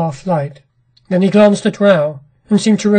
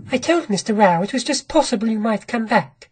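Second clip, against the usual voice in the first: soft, higher, controlled woman's voice; never quivering; 4 semitones higher, 5 dB quieter than usual. A narrator's reading. Wife covering up before her returned husband, steady and soft. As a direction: keep it quiet, high and perfectly steady. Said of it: "in a voice that never quivered".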